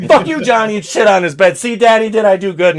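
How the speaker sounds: high, loud girlish mock voice; mock Amber. Voicing Amber to friends in a high loud put-on voice. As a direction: squeal it like a bratty girlfriend.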